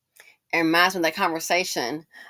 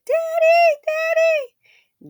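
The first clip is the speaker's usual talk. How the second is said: much higher, louder mock child's cry for daddy; imitated scared child calling out; 7 dB louder, much slower than usual. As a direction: wail it like a frightened little kid. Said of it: "you can hear the child just scared".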